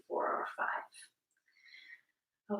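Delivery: very slow, breathy whispered count; slow whispered exhale. Shown as a demonstration. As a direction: let the count drift out on the breath. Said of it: "exhaling slowly to a count of".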